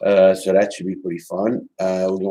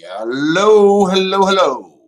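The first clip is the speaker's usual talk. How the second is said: raised, higher call into the mic; calling out hello; 9 semitones higher, 6 dB louder, slower than usual. Real, not acted. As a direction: call out to see if anyone hears. Checking if stream audio works.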